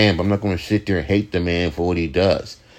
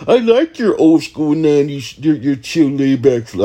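mocking fan voice; 4 semitones higher, 3 dB louder than usual. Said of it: "Other hand".

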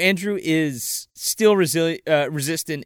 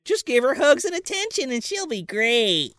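higher cutesy character voice; mock cheery advice; 8 semitones higher than usual. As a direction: chirp it like a cartoon helper. Voices Huggins's cheery solution.

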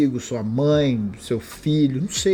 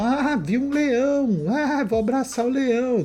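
naive dreamy voice; 10 semitones higher, slower than usual. Retold or imitated. Mock naive person hugging lion.

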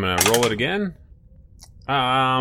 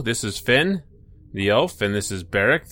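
ordinary talk, nothing marked

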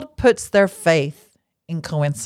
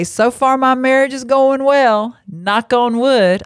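drawn-out, higher Southern voice; slow Southern twang, pitched up; Southern accent; 7 semitones higher, 3 dB louder, slower than usual. Acted. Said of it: "I'm giving a Southern voice".

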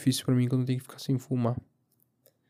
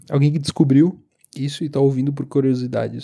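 usual speaking voice

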